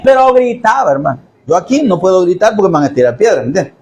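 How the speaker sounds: usual speaking voice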